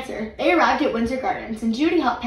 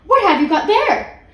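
startled; 9 semitones higher, 7 dB louder than usual. A narrator's reading. Housekeeper startled by a bear.

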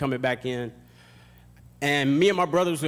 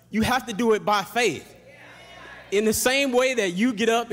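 high, loud building sermon cry; fervent preacher climax; preaching at full force; 8 semitones higher, 4 dB louder than usual. Acted. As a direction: drive the point home to the congregation.

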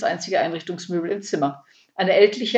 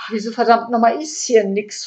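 louder, higher baffled cursing; bewildered; 4 semitones higher, 5 dB louder than usual. A narrator's reading. Burglar stares at bare walls, bewildered. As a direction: demand it, slightly dumbfounded. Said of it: "fragte Ede leicht belämmert".

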